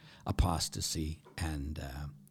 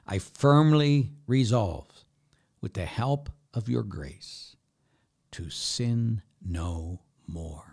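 weighty, measured recital with long pauses; slow emphatic recital; slower than usual. Shown as a demonstration. Priest repeats the prayer so listeners hear it, slow and emphatic. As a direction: spell out each phrase slowly and deliberately.

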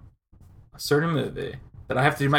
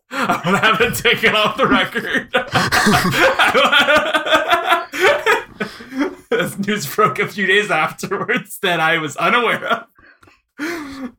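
talking through real laughter; words broken up by giggles; laughing; 5 semitones higher, 3 dB louder than usual. Real, not acted. Laughing while speaking.

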